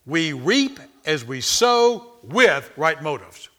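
loud, slow preacher's cadence; preaching. Acted. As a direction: drive the point home to the congregation. Preaching at full force.

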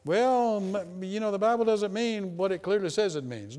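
high mock voice of a doubter explaining the Bible away. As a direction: whine it out like a skeptic dodging scripture. Preacher mocks doubter's excuse.

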